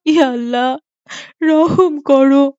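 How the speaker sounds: slow, tearful plea for mercy; crying plea. Acted. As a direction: sob out a plea to God. Mother begs after daughter dies. (মৃত মেয়ের জন্য মা কাঁদছে, ধীর কান্নাভরা মিনতি)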